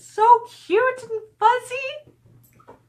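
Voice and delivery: very high, slow, cutesy coo. Real, not acted. Cooing at a fan's duckling pictures, high and slow. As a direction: melt over something adorable.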